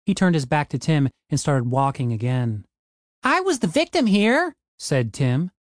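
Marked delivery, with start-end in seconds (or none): 3.2-4.5 high, whining defensive voice; whiny high protest. Acted. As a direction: plead innocence in a high whine. Tim protesting his innocence.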